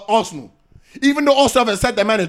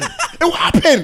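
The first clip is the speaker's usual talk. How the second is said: higher, slow, stretched through a laugh; laughing taunt; 5 semitones higher, much slower than usual. Real, not acted. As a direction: gloat over it, laughing as you drag it out. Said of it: "repeats it, laughing".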